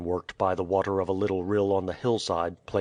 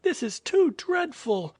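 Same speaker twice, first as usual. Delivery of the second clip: sobbing. Acted. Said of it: "the sobbing girl".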